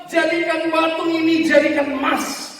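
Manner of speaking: high, loud imploring cry, drawn out at the end; desperate prayer. Retold or imitated. Preacher voicing the weeping pastor's prayer, desperate cry. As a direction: cry out to God in acted desperation. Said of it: "Sampai dia berdoa".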